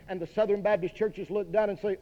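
ordinary talk, nothing marked

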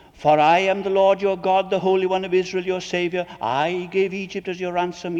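loud, solemn reading of the prophet's words; scripture reading. Acted. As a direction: proclaim the Lord's promise to the congregation. Reads Isaiah aloud.

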